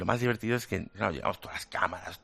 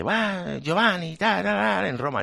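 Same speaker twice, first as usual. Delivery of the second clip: Italian driver; 9 semitones higher, 3 dB louder than usual. Retold or imitated. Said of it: "Y me dice".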